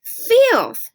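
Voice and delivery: high, loud, scornful mother; a mother's sharp disgust; disgusted Mama. Acted. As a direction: spit it out like a fussy mother. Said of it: "she'd say about the hat".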